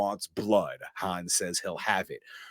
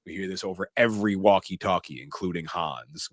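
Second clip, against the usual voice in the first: usual speaking voice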